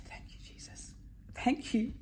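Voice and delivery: softly whispered prayer of relief; whispered thanks. Real, not acted. Seeing her bag first on the carousel, a whispered thanks. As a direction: breathe out thanks to Jesus.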